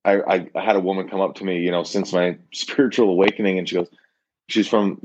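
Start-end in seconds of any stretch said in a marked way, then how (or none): none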